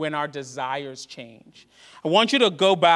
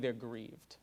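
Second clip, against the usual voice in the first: quiet grief; 7 semitones lower, 17 dB quieter than usual. Shown as a demonstration. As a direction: sink low and soft on grieved. Contrasting grief with anger.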